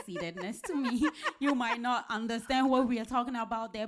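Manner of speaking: laughing while teasing. Real, not acted. Host teasing guest, laughing.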